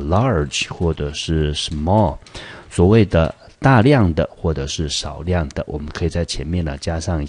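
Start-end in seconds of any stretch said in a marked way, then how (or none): 0.0-2.2 "large" and "small" drawn out slowly; slow model words. Shown as a demonstration. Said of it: "类似的形容词".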